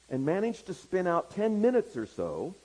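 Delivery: ordinary talk, nothing marked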